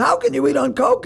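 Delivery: high, disbelieving mock voice; mock incredulous friend. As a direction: squeak it out in disbelief. Leary voiced in coke story.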